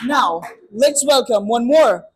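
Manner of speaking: loud, slow announcer voice; announcer call. Real, not acted. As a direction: call the next pair up to the stage. Host calling up next pair.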